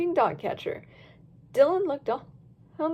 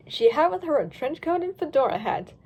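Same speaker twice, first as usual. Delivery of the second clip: ordinary delivery, nothing marked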